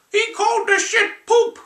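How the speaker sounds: very high, squeaky put-on man-child voice; Billy Madison voice. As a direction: squeak it out like a goofy overgrown kid. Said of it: "he does a lot of voices in movies".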